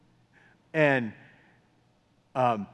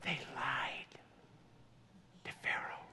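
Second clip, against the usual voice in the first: hushed, breathless whisper of a secret; dramatic whisper; 3 semitones lower, 21 dB quieter than usual. Acted. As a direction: whisper it like a confession to the congregation. Preacher whispers the key point.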